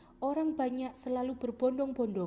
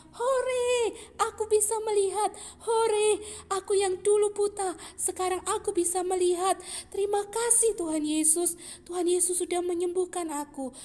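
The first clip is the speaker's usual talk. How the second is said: high, loud overjoyed shouting; joyful cheer; 9 semitones higher, 8 dB louder than usual. Acted. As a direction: cheer with joy at seeing again. (bersorak gembira, tinggi dan keras)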